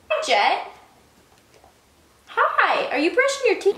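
high sing-song voice to a pet. Real, not acted. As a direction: coo at the dog. Greeting the dog.

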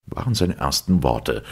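plain reading voice

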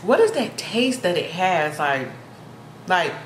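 talking between chews while tasting; slightly muffled mid-bite speech; mouth partly full, chewing. Real, not acted. Seen 0: Bite taken at -3; lips keep working and pursing between words.

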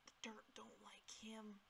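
whispered, secretive aside. Real, not acted. Player whispering about a mob, hushed whisper. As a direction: breathe it out as a secret.

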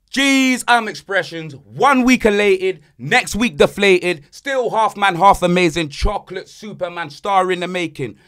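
loud rhyming hype-man intro; big it up like a showman. Acted. Host hypes up his co-host in a loud showman intro. Hyped podcast intro rhymes.

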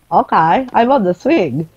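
ordinary talk

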